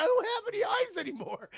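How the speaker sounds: high, loud mock-anguished cry; hammed-up distressed shout; wailing protest. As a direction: whine it in agony. Mutilated Justin answers, acted.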